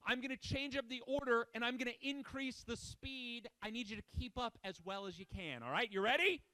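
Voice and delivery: ordinary talk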